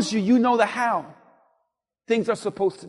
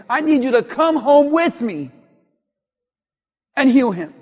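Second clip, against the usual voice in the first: louder mock order to Jesus; bossy instruction; 3 semitones higher, 7 dB louder than usual. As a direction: act out dictating how it is done. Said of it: "here's the how to do it".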